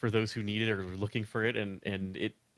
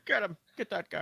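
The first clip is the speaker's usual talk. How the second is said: mock George order; 9 semitones higher than usual. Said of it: "come through the microphone saying".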